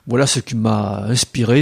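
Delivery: usual speaking voice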